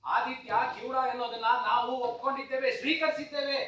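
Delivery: loud, high outburst building to a yell; angry shouting. Acted. Father demanding the next step from doctor, angry shouting. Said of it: "doctor ಮೇಲೆ ಹೀಗೆಲ್ಲ ಕೂಗಾಡೋದ್ರಿಂದ".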